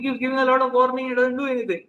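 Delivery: ordinary talk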